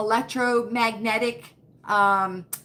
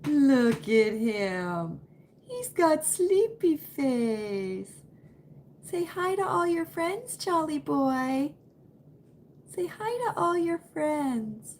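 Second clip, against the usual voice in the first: cooing at dog; 3 semitones higher, slower than usual. Real, not acted. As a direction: coo over the sleepy puppy, drawn out.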